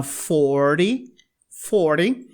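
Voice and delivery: forty said fɔɾi with a tapped T; flapped T. Shown as a demonstration. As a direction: repeat forty with the flap. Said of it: "ele vira um flap". (forty com T batido)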